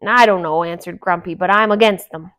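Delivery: loud, scornful grouch; gruff old dwarf warning the others off; cranky dwarf ranting about females; grumpy dwarf. A narrator's reading. Grumpy voiced again.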